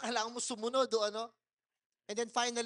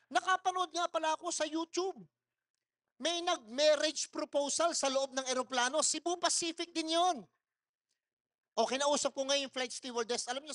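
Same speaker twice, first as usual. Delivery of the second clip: ordinary talk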